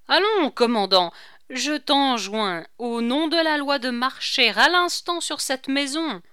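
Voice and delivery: loud, high, emphatic order; urgent, ringing official demand; imperious command. A narrator's reading. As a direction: summon him to act at once. Corentin formally orders the commander.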